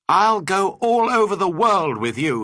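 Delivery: high, loud, excited pledge; eager cry. A narrator's reading. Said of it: "he cried".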